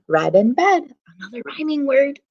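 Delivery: plain reading voice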